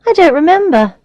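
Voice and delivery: high-voiced, dismissive boredom. A narrator's reading. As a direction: brush it off as not worth remembering. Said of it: "sounding bored".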